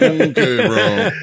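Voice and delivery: high, laughing dismissive okay; laughing mock okay bro. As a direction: laugh through a mock brush-off. Mocking Iraqis' disbelief.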